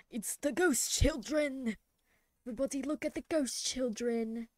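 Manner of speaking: hamming up a spooky showman; mock spooky announcer. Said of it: "mock spooky announcing the illustration".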